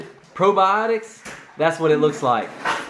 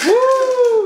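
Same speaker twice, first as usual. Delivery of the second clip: high loud whoop of delight; excited whoop; 5 dB louder than usual. Real, not acted. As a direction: cheer the dog on. Dog gulps the milk.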